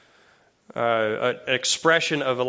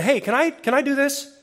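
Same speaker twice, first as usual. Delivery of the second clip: put-on high kid's voice; child asking; 10 semitones higher than usual. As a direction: ask like an eager little kid. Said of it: "That wasn't".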